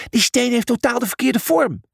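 hoge waarschuwende uitroep; geschreeuwd. A narrator's reading. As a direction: roep je waarschuwing heel hoog. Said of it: "schreeuwde hij". (hoog uitgeroepen waarschuwing)

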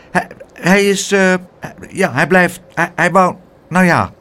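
put-on boy stumbling over his words; hesitant, restarting every phrase; stammering boy. Pluk caught off guard by the aunt's question, stammering. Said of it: "begon te hakkelen".

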